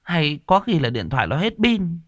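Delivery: high, scolding voice of a worried wife; reproachful wife. A narrator's reading. Wife dismissing husband's worry about their daughter, reproachful. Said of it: "trách chồng".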